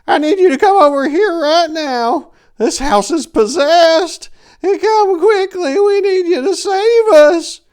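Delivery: put-on very high, loud panicked old-lady screech. A narrator's reading. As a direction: scream his title like she's terrified. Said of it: "the old lady screeched".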